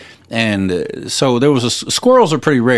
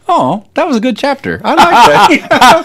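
high, stretched, loud "I like that"; pleased gushing quote; delighted reader; 12 semitones higher, 6 dB louder than usual. Retold or imitated. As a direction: gush over a chapter you loved. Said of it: "you're like".

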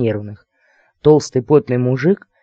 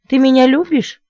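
high, loud, menacing question; threatening; 12 semitones higher, 6 dB louder than usual. A narrator's reading. Lady to wavering suitor, threatening. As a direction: make the love question a squealed threat. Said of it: "Угроза сквозила в каждом звуке".

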